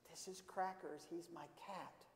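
put-on very soft little-boy reply; timid, hushed. Carlo introduces his cat to the librarian, hushed. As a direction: answer in a small, hushed voice.

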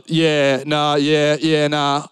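low, drawn-out Australian mumble of agreement; Aussie cafe worker. Acted. Person serving you at the cafe, broad Australian. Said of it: "of the person out at the cafe".

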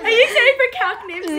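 high, fast, through laughter; laughing. Real, not acted. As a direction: ask while still laughing. Still laughing at friend.

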